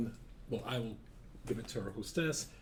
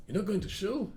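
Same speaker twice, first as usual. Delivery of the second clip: surprised question; 10 semitones higher, 5 dB louder than usual. Retold or imitated. Young man shocked at answer.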